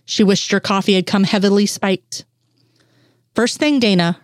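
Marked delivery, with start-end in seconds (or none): none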